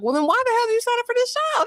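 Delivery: high, fast imitation of a pleading wife; mock exasperated plea. Friend mimicking what Michelle should ask, high pitched.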